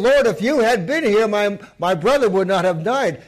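loud, high, hurt accusation; second sister's reproach. Acted. Other sister repeats the blame to Jesus, loud hurt reproach. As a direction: throw the same blame at him. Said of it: "the other one said the same thing".

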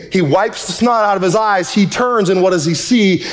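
ordinary talk, nothing marked

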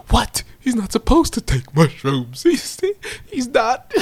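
high incredulous imitation breaking into laughter; mock shock. Acts out brother's shocked reaction.

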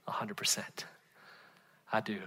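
low, breathless whisper of conviction; whispered affirmation to the congregation; whispered certainty. Real, not acted. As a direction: whisper it like a secret you're sure of. Emphatic answer to the congregation.

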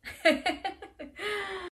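bright, high giggle; high laugh. Real, not acted. Storyteller laughs.

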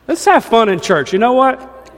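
high, loud and rousing; excited. Real, not acted. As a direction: pump up the crowd. Rousing the congregation.

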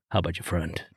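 cheeky, dropped low and quiet; sly soft follow-up. Retold or imitated. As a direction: slip it in softly, teasing. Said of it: "I'll say".

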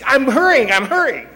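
loud, high mock-frantic protest; hammed-up flustered shout; flustered hurrying. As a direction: imitate a harried spouse calling out in a rush. Imitates wife rushed before church.

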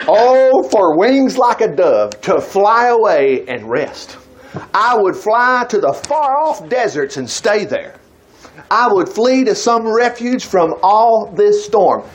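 slow, yearning, drawn-out plea; slow pleading scripture reading. Acted. Preacher reads the psalm to his church, slow and yearning. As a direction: read it like a longing prayer.

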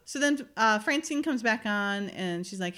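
normal talking voice

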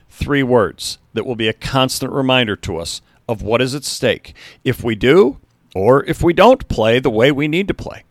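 ordinary delivery, nothing marked

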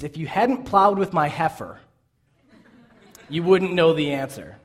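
a pointed, spiteful jab; mean retort. Retold or imitated. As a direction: throw the line back at them with a sneer. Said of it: "really mean way of saying".

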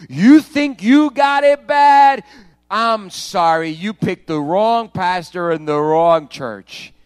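loud, slow, scolding preacher; shouting rebuke. Real, not acted. Pastor scolding his congregation from the pulpit, shouting. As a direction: hammer it home at full volume, slow.

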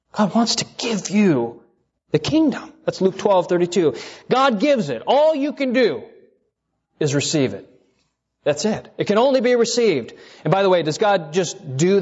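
high, ringing preacher emphasis; fervent pulpit climax; preaching at full force. Acted. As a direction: lift the voice and drive the point home. Preaching rising to high emphatic pitch.

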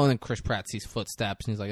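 normal talking voice

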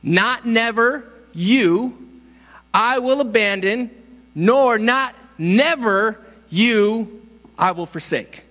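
slow, punched never; emphatic literal reading. Shown as a demonstration. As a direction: hammer each never.

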